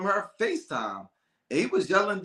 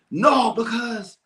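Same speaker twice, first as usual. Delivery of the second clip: mock angry interruption; 5 semitones higher, 5 dB louder, slower than usual. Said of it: "imitating the angry boyfriend".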